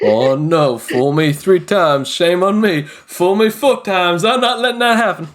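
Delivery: high, hammed-up panic; mock panic. GM mocking the player's panic with hammed-up dread.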